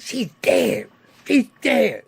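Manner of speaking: high, loud angry outburst; outraged threat. Retold or imitated. Man learning he was robbed of millions, outraged. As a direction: declare it twice, high and forceful. Acted furious threat after money news.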